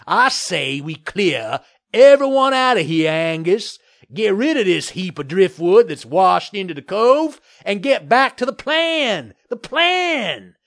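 loud, insistent blustering developer; worked-up bluster. A narrator's reading. Developer poking the mayor as he demands clearance, worked-up bluster.

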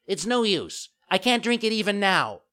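put-on high, loud puppet child; whining refusal. A narrator's reading. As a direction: protest as a fussy child. Puppet makes excuses.

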